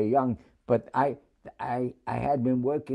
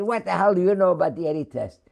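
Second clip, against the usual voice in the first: louder and fast, a challenging sailor; gruff sailor demand; 6 semitones higher, 5 dB louder, faster than usual. Retold or imitated. Sailor at a desk questions a naked recruit: fast, louder, demanding. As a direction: rattle it off like a suspicious sailor.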